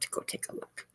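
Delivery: whispered repeat, airy and soft. Shown as a demonstration. Streamer urging viewers to check channel, whispering. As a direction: breathe it as a secret aside. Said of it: "whispered repeat".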